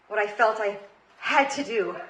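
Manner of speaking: ordinary delivery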